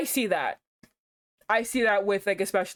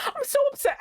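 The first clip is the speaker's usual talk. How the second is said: squealed upset; faster than usual. Real, not acted.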